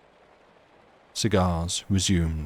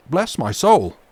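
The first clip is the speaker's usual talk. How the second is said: startled exclamation; 7 semitones higher, 7 dB louder than usual. Acted. Captain startled by a cough.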